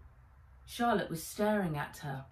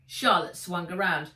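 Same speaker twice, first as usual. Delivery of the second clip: ordinary delivery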